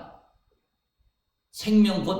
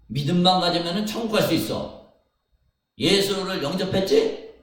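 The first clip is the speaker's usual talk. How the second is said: mockingly voicing an easy promise, rising louder; preacher acting out a sweet lie, getting louder; mock false promise; 6 semitones higher, 5 dB louder than usual. Sermon parody of a false Jesus, rising and louder.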